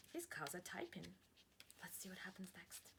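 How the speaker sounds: suspenseful whisper. Real, not acted. Teacher teasing the next page, whispering.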